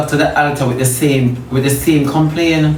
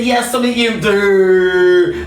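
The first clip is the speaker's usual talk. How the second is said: mock voice of God; 3 semitones higher, slower than usual. Preacher mocks people claiming they hear God, exaggerated calling voice. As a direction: parody a heavenly voice with stretched cries. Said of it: "nobody never say so".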